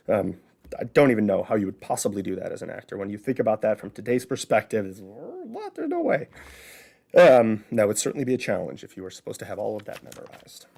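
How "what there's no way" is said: high, squeaky incredulous cry; mock disbelief. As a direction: play the baffled modern actor. Mock disbelief from today's view.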